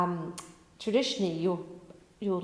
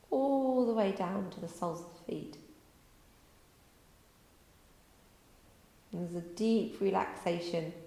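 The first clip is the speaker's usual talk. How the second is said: guided relaxation; 4 dB quieter than usual. Acted. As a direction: lull them down into stillness.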